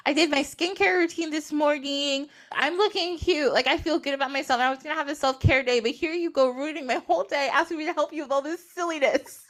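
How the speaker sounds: high-pitched exasperated rant; acted indignant complaint; irritated. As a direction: play up being put out by a favor. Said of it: "She's so irritated".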